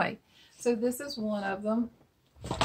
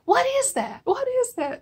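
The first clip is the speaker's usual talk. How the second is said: high, louder bewildered repeat; mock baffled; 14 semitones higher, 6 dB louder than usual. Cooks baffled by the old song, high puzzled cry. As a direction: ham up the cooks' confusion. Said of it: "and they're like".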